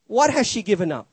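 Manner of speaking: ordinary talk, nothing marked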